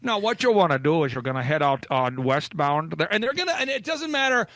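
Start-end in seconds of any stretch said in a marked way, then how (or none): 0.0-3.1 low, helpful Minnesotan giving directions; a fussing Minnesota local; Minnesotan host. Retold or imitated. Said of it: "guide you down the driveway".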